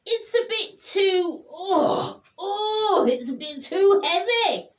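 lightly voiced big bear; bear character voice. A narrator's reading. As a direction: speak as the cuddly bear, a bit high.

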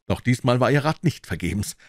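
plain reading voice

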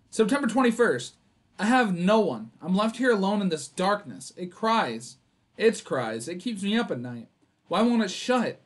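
a lightly voiced desperate father; reading diary aloud. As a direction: read the grieving father's lines with rising despair.

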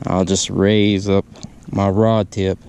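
ordinary talk

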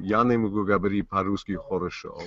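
a line of Russian in his Russian-accented film voice; speaking Russian. Shown as a demonstration. Actor demonstrating his opening Russian line to the panel, in Russian. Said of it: "me speaking in Russian".

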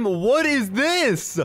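high, drawn-out disbelief; astonished. Real, not acted. Player seeing four people interviewed at a time, astonished. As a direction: stretch it out in rising astonishment.

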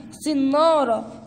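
صنارة stretched slowly, each syllable held; drawn out. Shown as a demonstration. Teacher stretching صنارة so the kids hear each sound. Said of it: "ممتازين".

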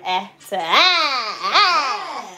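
small "ah" growing into long, loud "ah"; imitated length change. Shown as a demonstration. Showing how much longer the braid got, playful stretched ah. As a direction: stretch the last "ah" out big and rising.

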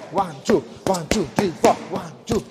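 normal talking voice

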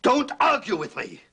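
high, loud, furious command; explosive high-pitched outburst; angry shout. Acted. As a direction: shout her down to end the argument. Husband shuts down the argument.

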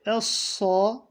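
Essa with a heavy, dark s; Essa, thick sad; thick emphatic s. Shown as a demonstration. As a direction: darken the s in Essa. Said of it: "kalın okunacak".

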